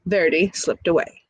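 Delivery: usual speaking voice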